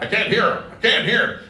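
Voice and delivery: high, loud mocking whine; mock complaint. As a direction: whine it like a grumpy bass singer. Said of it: "the basses were always like".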